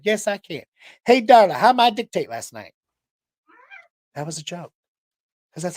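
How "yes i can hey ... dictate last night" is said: Buckwheat voice. Retold or imitated. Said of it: "He said".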